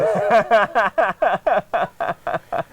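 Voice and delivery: a burst of real laughter; open, amused laugh. Real, not acted. Laughing at the survey result.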